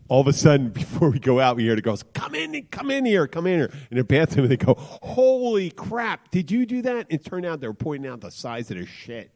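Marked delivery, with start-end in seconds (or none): 2.1-3.7 put-on high-pitched, urgent girlish calls; mimicking girls beckoning, pitched way up; excited calling out. Retold girls calling friends over. 4.8-7.1 high, drawn-out mock astonishment; imitating astonished girls, pitched way up; amazed exclamation. Acting out girls' amazed reaction.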